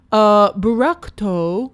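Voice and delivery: slow, loud mock Japanese accent, imitating Bob; mocking the chef in a put-on Japanese accent, drawn out. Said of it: "in a very racist Japanese accent".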